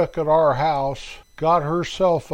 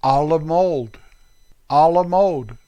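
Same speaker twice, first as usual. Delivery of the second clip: "A la mode" in a plain American accent; American-accented French; 4 dB louder than usual. Shown as a demonstration. Vlogger saying A la mode before correcting himself in an American accent. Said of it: "Darn American accent".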